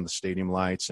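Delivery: ordinary talk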